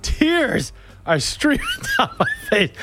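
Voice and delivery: words breaking up with laughs; laughing. Real, not acted. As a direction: laugh your way through the line. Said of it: "I'm laughing so hard".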